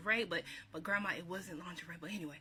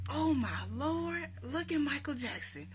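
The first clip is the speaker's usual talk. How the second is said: high, drawn-out exclamation, imitating grandma; mock amazement, pitched up and stretched out; exclaiming grandma; 8 semitones higher, 4 dB louder than usual.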